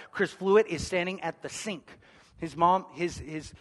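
ordinary talk, nothing marked

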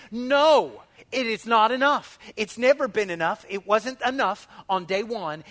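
loud, emphatic preaching; fervent preaching. Acted. Pastor insisting to the church, loud and fervent. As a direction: drive it home loudly.

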